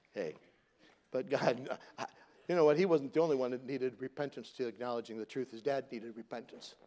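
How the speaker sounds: usual speaking voice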